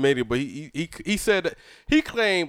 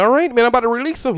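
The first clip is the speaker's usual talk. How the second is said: quick, raised imitated voice; coworker impression; 7 semitones higher, 5 dB louder, faster than usual. Coworker announcing a release, quick mock voice. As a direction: imitate him loudly, high and fast. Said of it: "He be like".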